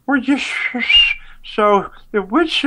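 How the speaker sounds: mocking bad drunk acting, pitched high; fake drunk. Mocking an actor asking about the army, fake drunk. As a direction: slur it like an actor overplaying drunk. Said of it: "trying to act drunk, like".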